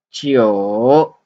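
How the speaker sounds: Jiu dipping low, then rising; dipping Mandarin tone on Jiu; third tone. Shown as a demonstration. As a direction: swing Jiu down and back up. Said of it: "in third tone".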